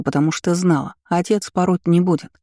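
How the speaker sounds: ordinary delivery, nothing marked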